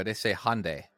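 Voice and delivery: Hyundai said HON-day; American pronunciation. Shown as a demonstration. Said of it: "sounds different in America".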